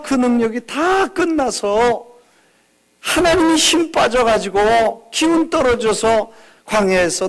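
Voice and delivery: pleading high and loud, dragging the first words; impassioned plea. Pretend. Moses arguing with God, fervent loud plea. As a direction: argue with God, fervent and rising.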